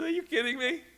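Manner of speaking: high-pitched, amused incredulous question; amused disbelief. Retold or imitated. Brother reacting to the spa plan, amused disbelief. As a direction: imitate someone starting to laugh in disbelief. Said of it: "he starts to laugh".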